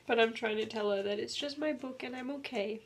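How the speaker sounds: soft, teary near-whisper; tender thanks through tears; very quiet, choked up after crying; real tearful voice. Real, not acted. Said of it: "I started crying".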